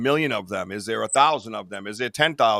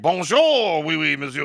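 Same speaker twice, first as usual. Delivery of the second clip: hammed-up French greeting, pitched up; mock French accent; 5 semitones higher, 4 dB louder, slower than usual. Host imitating his French viewers, mock French accent. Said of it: "They're all like".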